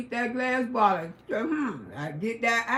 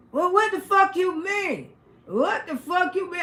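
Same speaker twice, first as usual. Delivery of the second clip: hostile retort; 6 semitones higher than usual. Retold or imitated. The smoker in the bar snapping back, a mock angry retort. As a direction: play the rude woman picking a fight.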